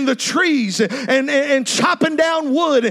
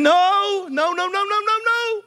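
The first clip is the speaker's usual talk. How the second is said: higher, climbing pleading nos; mock panicked denial; rising panic; 6 semitones higher than usual. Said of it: "You say".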